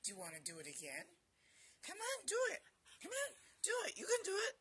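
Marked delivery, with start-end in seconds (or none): 1.8-4.6 very high, eager urging; puppy talk. Real, not acted. Owner urging puppy to do it again, high puppy talk. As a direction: egg the puppy on in a squeaky-high voice.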